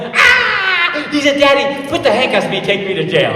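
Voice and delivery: high squeal tumbling into a loud plea; excited child. Retold or imitated. Son begging dad to cuff him, wildly excited. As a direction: imitate a thrilled little boy begging, loud and high. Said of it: "He got so excited".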